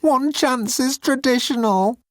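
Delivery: high, slow pleading; high desperate pleading alien; begging. Acted. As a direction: beg for mercy. Moan's voice.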